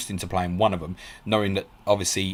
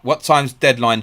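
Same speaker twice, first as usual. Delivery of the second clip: sudden alarm; 5 semitones higher, 8 dB louder, slower than usual. Real, not acted. Realises he may have missed deadline.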